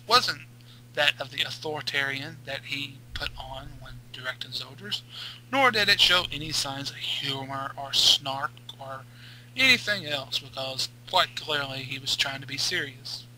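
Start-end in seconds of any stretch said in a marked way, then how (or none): none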